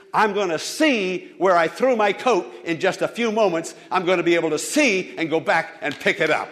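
loud, high preacher build; impassioned preaching. Acted. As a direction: drive it home to them. Preaching at full force.

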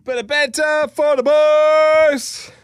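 high, loud, drawn-out catchphrase; mock cheer. Co-host capping the banter story, hammed-up high cheer. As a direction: belt it out and hold the last word.

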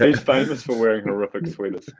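ordinary talk

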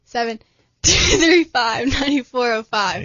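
slow and deliberate digits. Shown as a demonstration. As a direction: read the number very slowly. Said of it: "Slow down and say that one more time".

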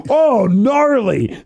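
slow, drawn-out high awe; impressed onlooker. Retold or imitated. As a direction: stretch it out like an awed dude. Imitating impressed onlooker at hospital.

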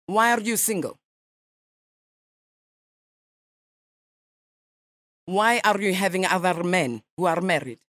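a light mock Russian accent, nasal ma'am; radio prank caller in a lightly faked Russian accent; put-on Russian accent. Prankster calls a stranger as a Russian journalist, mock Russian accent. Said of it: "for a Russian, your English is quite good".

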